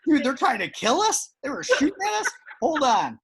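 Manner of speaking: loud, high-pitched incredulous protest; hammed-up alarm while fleeing; mock panicked exclaiming. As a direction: play a frantic runaway, voice jumping up. Acting out fleeing from the mafia.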